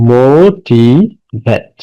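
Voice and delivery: "Motivate" drawn out very slowly, pausing between মো, টি and ভেট; slow teaching breakdown of "motivate", one syllable at a time. Shown as a demonstration. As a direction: break "motivate" into three long, separate syllables. Teacher splits word to count syllables.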